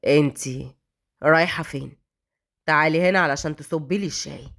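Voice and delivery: low, unhurried cold male character. A narrator's reading. Raad stops the maid as she leaves, cold. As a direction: order her in a deep, icy voice. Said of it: "بصلها ببرود من فوق لتحت وهو بيقول".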